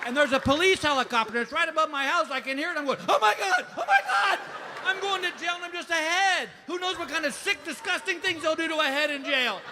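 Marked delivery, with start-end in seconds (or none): 3.1-9.6 high, hammed-up panic; freaking out. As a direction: cry out in mock terror of the police. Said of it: "And I am freaking out".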